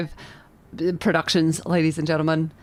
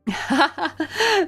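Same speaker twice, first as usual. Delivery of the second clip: happy laugh; 10 semitones higher than usual. Real, not acted. Greets arriving friend.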